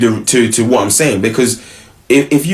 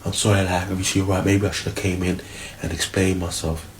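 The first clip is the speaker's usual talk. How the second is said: much softer, lower sorry; soft apology; 5 semitones lower, 10 dB quieter than usual. Retold or imitated. Girlfriend quietly apologizing to her boyfriend upstairs. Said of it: "She goes".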